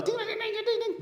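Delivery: high sing-song ding ding like a ringing bell; bell imitation. Speaker imitates the job bell to the crowd, high sing-song ding. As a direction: mimic the celebration bell, high and bright.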